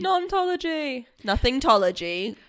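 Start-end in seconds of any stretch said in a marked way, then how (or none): 0.0-1.1 Nontology said slowly and pitched high; disbelieving repeat of Nontology; incredulous echo. Real, not acted. Friend echoing the suspect's made-up subject with disbelief.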